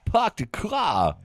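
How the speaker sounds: high, very slow, badly faked star's delivery; Christopher Walken. Shown as a demonstration. Asked for other words, high slow mock Walken. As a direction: stretch it out in a hammed-up impression. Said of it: "Can you do any other words?".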